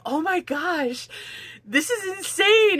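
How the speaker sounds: high, slow stunned gasp of a husband; slow, high disbelief at the mashed potato mountain; amazed husband. Retold or imitated. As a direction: play the husband floored by the food. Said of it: "he was just like".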